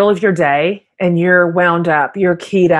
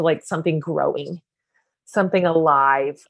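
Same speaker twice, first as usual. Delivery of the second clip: ordinary talk, nothing marked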